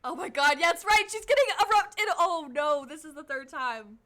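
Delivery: gleeful yelling at the screen; excited outburst. Real, not acted. Streamer thrilled at the judge's eruption, loud excited shout.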